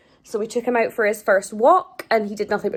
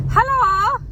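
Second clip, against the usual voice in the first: high bright hello; 12 semitones higher than usual. Real, not acted. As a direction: greet the pet in a high voice. Greeting the dog.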